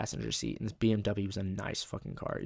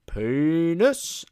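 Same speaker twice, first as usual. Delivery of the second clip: loud, high, stretched-out cry; escalating game call; 7 semitones higher, 9 dB louder than usual. Retold or imitated. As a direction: raise the stakes with a long rising call. Game gets louder each turn.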